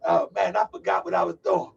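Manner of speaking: ordinary talk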